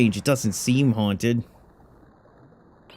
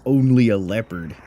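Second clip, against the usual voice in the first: ordinary delivery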